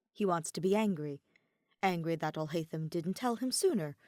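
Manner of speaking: ordinary delivery, nothing marked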